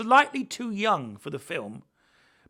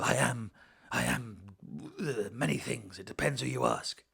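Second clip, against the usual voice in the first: low, hesitant Batman musing; low and quiet, like a wordy Batman; rambling Batman voice; 6 semitones lower, 4 dB quieter than usual. Retold or imitated. Parodying a long-winded Batman.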